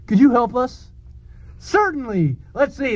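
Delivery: normal talking voice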